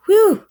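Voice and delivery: high, loud whew of relief; relieved whew. Acted. Boy sees the line still open, loud relieved whew. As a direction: blow out a big relieved whew. Boy reaches mission corner.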